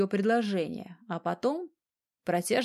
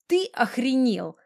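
slow, higher-pitched indignant grumble; grumbling; 4 semitones higher, 3 dB louder than usual. A narrator's reading. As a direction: drag it out crossly, without squealing. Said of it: "заворчала". (медленно, ворчливо)

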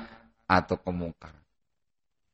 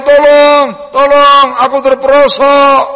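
loud, high cries for help; crying for help; 10 semitones higher, 7 dB louder than usual. Acted. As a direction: call for help as if fallen into a ravine. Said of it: "kamu teriak minta tolong".

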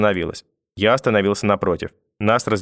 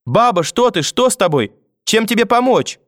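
put-on high, anxious little-boy voice; panicked child; 13 semitones higher, 4 dB louder than usual. A narrator's reading. Grandson over collapsed grandmother, frightened and pleading. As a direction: plead urgently, pitched way up.

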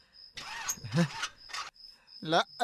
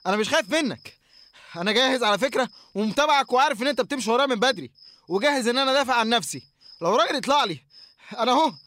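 loud, higher challenge, bravado covering fear; defiant shout; 4 semitones higher, 5 dB louder than usual. Acted. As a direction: shout him down to seem brave. Confronting the figure in the dark. (تحدي بصوت عالي يخفي الخوف)

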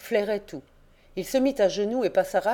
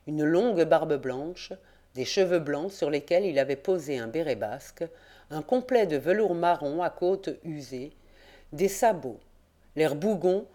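plain reading voice

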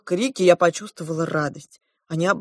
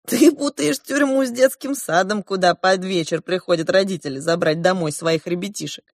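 plain reading voice

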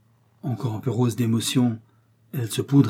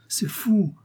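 put-on startled girl; 10 semitones higher than usual. A narrator's reading.

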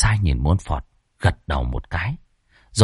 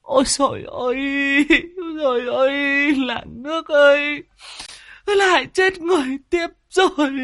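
panicked wail. Acted. Old woman crying out to the village over a death, panicked wail. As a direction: wail in alarm, stretching each cry. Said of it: "tiếng hô thất thanh".

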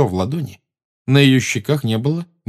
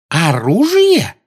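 slow, loud thrilled cry; exclaiming; 8 semitones higher, 6 dB louder, much slower than usual. A narrator's reading. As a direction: cry out in delight. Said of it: "воскликнула Амбридж". (восторженный возглас)

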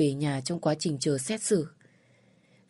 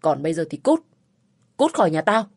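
a gruff order to get out, rising louder; angry eviction; 3 semitones higher, 5 dB louder than usual. Acted. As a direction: throw them out of the house. Man drives them out of house. (đuổi đi giận dữ, to dần)

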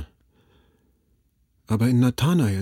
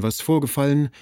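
ordinary delivery

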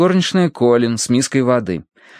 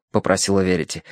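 ordinary delivery

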